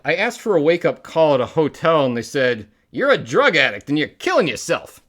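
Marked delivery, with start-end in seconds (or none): 2.9-5.0 mock scolding. Acted punchline of hotel joke.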